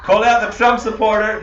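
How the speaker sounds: loud, high, laughing call across the room; laughing callout. Real, not acted. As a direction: shout it out while cracking up. Laughing tease at the audience.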